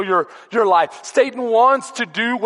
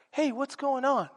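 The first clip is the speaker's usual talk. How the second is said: higher, softer oblivious hello; naive friendly greeting; 6 semitones higher, 7 dB quieter than usual. Retold or imitated. Christians walking unaware into the fight, naive friendly greeting. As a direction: greet softly, unaware of danger.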